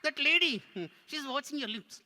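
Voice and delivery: high puppet voice; tattling puppet. Pretend. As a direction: tell on the lady as the puppet. Puppet tattles on audience member.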